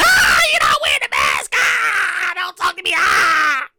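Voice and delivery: mock-panic back away from him; freaking out. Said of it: "that was my reaction".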